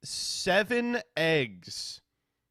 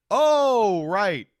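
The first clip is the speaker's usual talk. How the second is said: loud, high, drawn-out "oh"; hammed-up dawning recollection; imitated sudden realisation; 7 semitones higher, 10 dB louder than usual. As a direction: act out the penny dropping. Friend suddenly remembers time zones.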